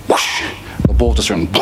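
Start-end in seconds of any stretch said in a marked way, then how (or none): 0.1-0.4 imitated whoosh of a golf swing. Golfer takes first swing, mimicked whoosh. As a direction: make a sharp swishing noise.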